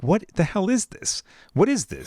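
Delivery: high incredulous question; shocked. Retold or imitated. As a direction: recoil in disgust. Retold shocked reaction.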